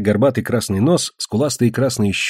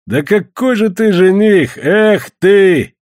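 high, drawn-out scoffing; loud, derisive jeer; mocking; 8 semitones higher, 5 dB louder, much slower than usual. A narrator's reading. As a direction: ridicule the would-be suitor loudly. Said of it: "начал его высмеивать".

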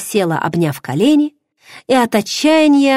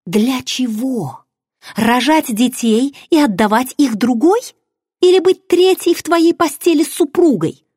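desperate shout; 7 semitones higher than usual. Acted. Heroine screams her despair at her lover. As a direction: scream your despair at him. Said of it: "отчаянно кричала я".

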